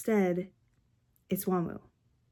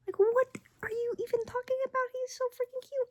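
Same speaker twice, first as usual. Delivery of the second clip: much higher, fast gushing; squealing; 14 semitones higher than usual. Real, not acted. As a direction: gush over how cute he is. Squealing over cute photo.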